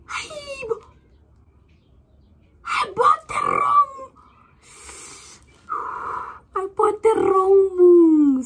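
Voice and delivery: high, loud crying shout; anguished wail. Real, not acted. Vlogger finding she bought the wrong item, loud high crying wail. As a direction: wail over the wrong purchase.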